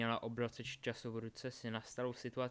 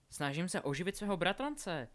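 higher, insisting on her story; insistent; 6 semitones higher, 4 dB louder than usual. A narrator's reading. Delphi defending her story to the boys, insistent. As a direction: protest that climbs in pitch. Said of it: "trvala na svém Delphi".

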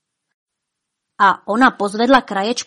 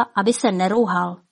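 usual speaking voice

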